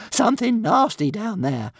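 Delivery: high, loud alarmed creature voice; frightened little dragon. A narrator's reading. Little dragon warning of danger below, alarmed. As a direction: warn them in a squawky panic. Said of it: "spiralling upwards on shaking wings".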